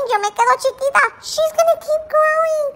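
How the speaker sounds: high, squeaky worried wail; whiny lament. Acted. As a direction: wail it like a jealous little dog. Dog lamenting puppy's size.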